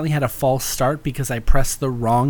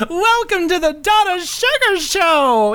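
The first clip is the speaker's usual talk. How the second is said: high, glamorous diva welcome; femme fatale; 4 dB louder than usual. Acted. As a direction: purr the show title like a showgirl. Persona greeting versus plain greeting.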